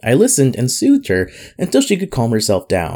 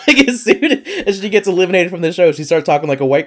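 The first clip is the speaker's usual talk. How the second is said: laughing; 8 semitones higher, 3 dB louder than usual. Real, not acted. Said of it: "laughing while telling".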